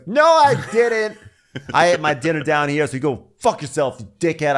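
loud, high indignant outburst; mock angry retort. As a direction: imitate a man snapping back defensively. Acted quarrel over dinner.

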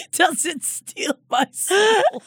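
high, slow mock wail; bewildered old-time president. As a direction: draw out the horrified question, high and tremulous. Repeats the Lincoln bit.

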